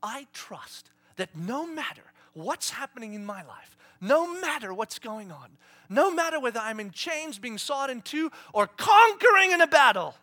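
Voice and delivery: starting plain, rising to loud, high proclamation; building to a shout. Real, not acted. Preacher declaring his trust, building to a loud cry.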